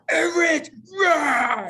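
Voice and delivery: an over-the-top mock scream of anger; overacted angry shout. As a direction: ham up a furious yell at Everett. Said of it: "I was overacting".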